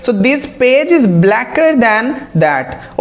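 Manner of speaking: very slow, word by word example; carefully dictated English sentence; slow dictation. Shown as a demonstration. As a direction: read the example slowly for students.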